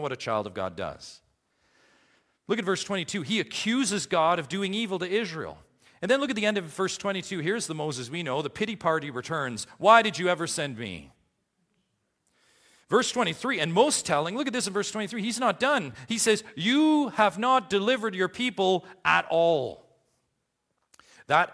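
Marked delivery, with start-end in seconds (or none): 2.5-16.4 high, loud pulpit delivery; full-force preaching. Acted. 16.6-19.9 loud, high stress on "You", carried through to "at all"; accusing Moses, punching "not" and "at all"; emphatic accusation. Acted. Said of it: "It's emphatic".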